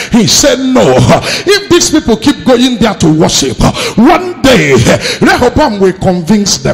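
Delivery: loud, high-pitched sermon delivery; full-force preaching. Real, not acted. Preacher rebuking pastors through Jeroboam's story, full-force preaching. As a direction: preach it hard, pushing every phrase.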